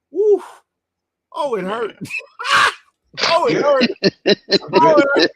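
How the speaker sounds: put-on high, loud and laughing at the old hurt; laughing pained howl. Man recalling his wrecked car, howling with laughter. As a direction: cry out in mock agony through laughter.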